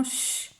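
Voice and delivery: "ch" as a drawn-out shhh; isolated sound. Shown as a demonstration. Teacher naming the sound heard in chat, long shhh. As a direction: hold the hushing consonant alone for "ch". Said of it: "Dans chat".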